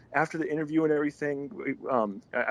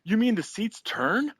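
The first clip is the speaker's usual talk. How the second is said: high, slow mock wonder; giddy amazement; 10 semitones higher, slower than usual. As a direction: marvel like an easily thrilled fan. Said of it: "all excited and going".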